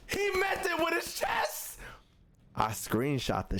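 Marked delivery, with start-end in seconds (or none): 0.1-1.8 very high, drawn-out comic disbelief; mock shocked outrage. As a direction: squeal it slowly in exaggerated shock. Mock outrage retelling the insult.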